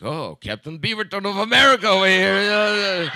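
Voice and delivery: mock the self-important guy; a teasing, sarcastic retort with a laugh; sarcastic mockery. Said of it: "And I was like".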